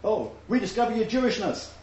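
hint of a parody of a trendy seeker; mocking their slogan. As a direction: sneer at the catchphrase lightly. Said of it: "they're all about, you know".

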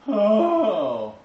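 high, falling groan of dismay; long mock-dismayed "ohh", sliding down; loud drawn-out "oh" of regret. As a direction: wail of missing out. Acts believer seeing what was lost.

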